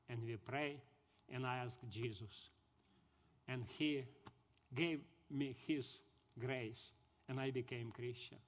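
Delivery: soft, low and solemn; hushed reverence. Real, not acted. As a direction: drop to a soft, low testimony of grace. Solemnly recalling his conversion prayer.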